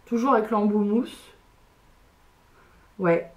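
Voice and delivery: ordinary talk, nothing marked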